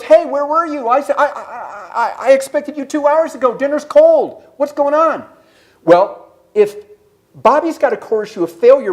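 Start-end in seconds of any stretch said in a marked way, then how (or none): 0.0-5.3 higher voice, mock scolding wife; exasperated wife. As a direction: ask like a put-out wife waiting with dinner. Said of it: "And Susie goes".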